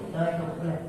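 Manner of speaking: soft, humble greeting; quiet, polite imitated greeting. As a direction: greet him gently, almost under the breath. Father politely greets toilet keeper.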